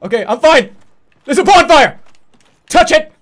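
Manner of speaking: loud, high and frantic; panicked rush. Real, not acted. Streamer fleeing a boss to the bonfire, frantic and loud. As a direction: blurt it out racing to safety.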